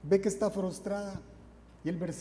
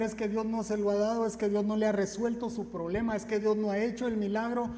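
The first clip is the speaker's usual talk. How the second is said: raised, higher, louder mock skeptic voice; mock doubters; 5 semitones higher, 5 dB louder than usual. Preacher mimicking judgmental churchgoers, louder, higher and mocking. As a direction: voice the doubters with exaggerated scorn.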